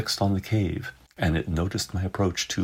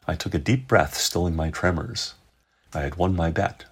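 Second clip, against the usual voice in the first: ordinary delivery, nothing marked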